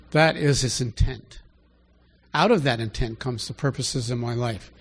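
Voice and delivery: normal talking voice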